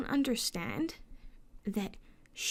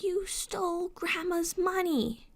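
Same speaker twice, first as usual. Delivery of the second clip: put-on child's accusation; 9 semitones higher, slower than usual. Little sister accusing her.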